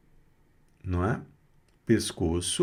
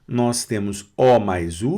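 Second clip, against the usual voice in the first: ordinary delivery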